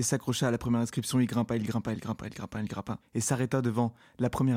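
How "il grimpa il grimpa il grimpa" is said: much softer, trailing away like an echo; fading repeat. A narrator's reading. Narrator's climb fading out, softer echo. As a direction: let the repeat die off into the distance.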